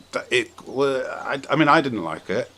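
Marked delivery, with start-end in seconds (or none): none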